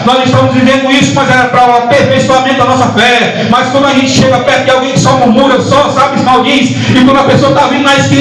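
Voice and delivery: high-pitched, driving sermon delivery; fervent Pentecostal preacher in full cry; full-force preaching. Real, not acted. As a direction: pile up the phrases with rising fervour.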